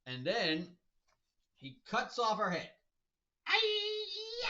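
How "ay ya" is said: high shouted yelp; hammed-up loud shriek; mock scream. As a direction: cry out as if struck. Head cut off.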